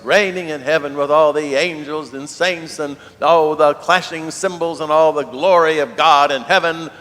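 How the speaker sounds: loud, swelling roll of heavenly splendour; grandiose proclamation. Acted. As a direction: build it big, piling up the glory. Mock-grand contrast to preaching crucified Christ.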